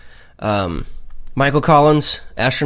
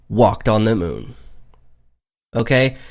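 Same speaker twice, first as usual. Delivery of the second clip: ordinary talk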